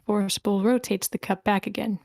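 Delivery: ordinary talk, nothing marked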